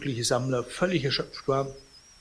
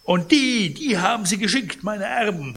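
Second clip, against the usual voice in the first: high, loud outrage; indignant cry; 9 semitones higher, 6 dB louder than usual. A narrator's reading. Sick man learns his heirs sent the visitor, indignant outcry. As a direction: burst out indignantly, voice raised. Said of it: "rief Pons empört".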